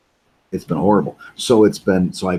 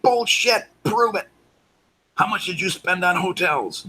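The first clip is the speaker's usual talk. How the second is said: mock disbelief; 12 semitones higher than usual. Mocking friend's boast.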